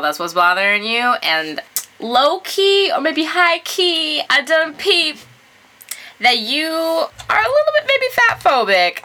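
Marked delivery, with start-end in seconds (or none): 2.0-9.0 high, sassy call-out. Real, not acted. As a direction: serve it with attitude, pitched up. Sassy call-out of the letter writer.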